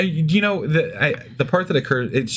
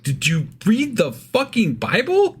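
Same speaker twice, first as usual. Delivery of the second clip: incredulous outburst; 9 semitones higher, slower than usual. Retold or imitated. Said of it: "And I'm like".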